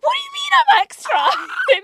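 very high shrieking mock outrage; mock outraged. Retelling being called extra, shrieking in mock outrage. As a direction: shriek it, laughing, fake offended. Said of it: "And I was like".

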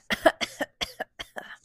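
string of high pretend coughs; acted cough. Rooster tries waking the sheep, pretend coughing fit. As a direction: cough repeatedly as the sick rooster. Rooster's sore throat in story.

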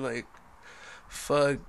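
ordinary talk

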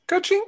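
high rising ka-ching; cash register ka-ching. Pretend. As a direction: chirp ka-ching like a till. Arcade coin sound.

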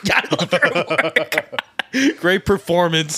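slow, high words through laughter; cracking up while saying it; laughing. Real, not acted. Fan praising his OnlyFans work, laughing through it.

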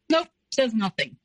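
usual speaking voice

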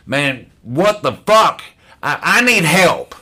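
high, exasperated plea; losing it. Retold or imitated. Newcomer raising his hand to beg for help, losing it. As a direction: burst out, at the end of your rope. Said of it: "I just lost it".

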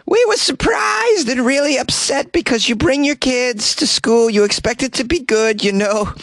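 mocking parent quote. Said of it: "There's a quote here from the surprised parent".

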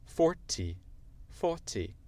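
British demo, T of forty sounded; British accent. Shown as a demonstration. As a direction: say forty the British way, faw-tee. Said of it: "Ça, c'est l'accent britannique".

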